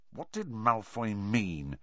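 soft, slow muttered question. Acted. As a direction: mutter it under your breath. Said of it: "Harry muttered".

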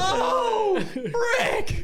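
high squealing laugh; laughing shock. Real, not acted. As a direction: shriek it through laughter.

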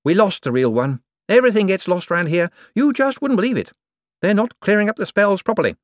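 high, eager, bright-voiced wizard; a jumpy official covering for himself. A narrator's reading. Hicks complaining about the department.

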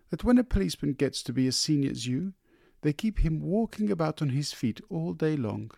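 high, quick wife's voice; sympathetic wife. A narrator's reading. Wife sympathizing about his long days, high and fast. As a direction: sympathize with him, high and hurried.

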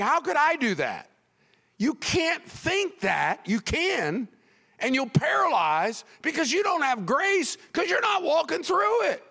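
high, driving preacher's delivery; forceful preaching. Real, not acted. Preacher warning worriers they can't have grace early, forceful. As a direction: preach it with urgency, pitch soaring.

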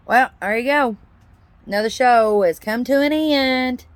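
loud, rousing sign-off speech; rhymed toast. Acted. Podcast host toasts listeners at show's end, loud and rousing. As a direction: raise a glass and rally the listeners.

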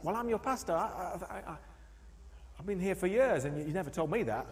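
acting a hurt, baffled pastor; flustered pastor. Pastor answering his congregant, stammering surprise. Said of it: "And he's like".

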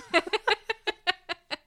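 loud squealing laugh; high burst of laughter. Real, not acted. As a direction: cackle up high. Laughing at Al's line.

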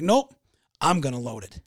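loud, emphatic imitation of a headstrong customer; stubborn refusal. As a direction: act out flatly turning down help. Stubborn customer refuses help, acted.

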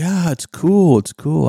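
high, loud casual gym-bro voice; gym guy brushing it off. Retold or imitated. The man he apologized to at the gym, high and loud. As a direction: toss it off like a stunned bro at the gym. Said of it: "he goes".

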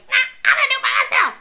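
high, loud, rushed retort; mock defiance. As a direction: blurt it out in hammed-up stubbornness. Voices heroine defying the advice.

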